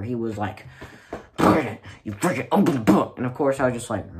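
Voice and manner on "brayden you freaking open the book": louder mock teacher yell. Teacher yelling at a student to open the book, loud mock yell. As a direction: yell it like an angry teacher in his ear. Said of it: "he screamed in my ear".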